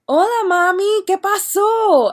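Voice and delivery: high, loud, drawn-out phone greeting; hammed-up greeting. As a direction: stretch it out in an exuberant singsong imitation. Friend hamming up phone greeting.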